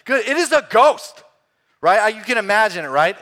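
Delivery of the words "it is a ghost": high, loud panicked shout; mock-terrified cry of a disciple; frightened cry. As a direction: scream it out as a spooked sailor.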